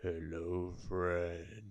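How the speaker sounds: low, soft, drawn-out monster greeting; deep sinister whisper of a dark figure; deep slow creepy voice. Retold or imitated. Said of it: "it was deep".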